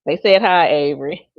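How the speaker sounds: loud relay across the room; calling out. Real, not acted. Relaying messages to others off mic.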